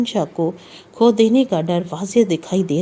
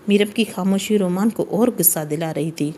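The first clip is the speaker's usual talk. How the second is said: usual speaking voice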